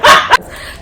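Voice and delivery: short high breathy laugh; airy laugh. Real, not acted. Teller laughing at her own joke, airy laugh. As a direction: laugh it off quickly. Laughing about Ike vibes.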